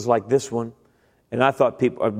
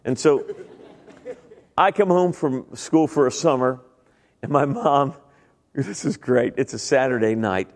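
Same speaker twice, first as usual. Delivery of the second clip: usual speaking voice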